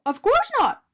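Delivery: high, loud eager little donut. A narrator's reading. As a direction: chirp it out agreeably. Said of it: "Arnie agreed".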